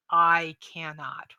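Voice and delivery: "I cannot" slow and deliberate; stress on CANNOT. Acted. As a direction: draw out and weight "cannot". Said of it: "she stresses that word".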